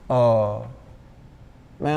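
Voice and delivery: ordinary talk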